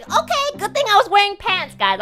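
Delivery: way up high, squeaking out the relief; high squeaky relief. Retold or imitated. Telling her stream she was glad of pants, in a high squeak. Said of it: "I was like".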